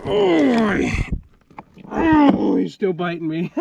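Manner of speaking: ordinary talk, nothing marked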